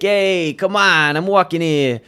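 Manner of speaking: mock New York tough-guy accent; imitating a slick-haired Jersey guy; a hint of New York attitude; East Coast accent. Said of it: "they talk like this".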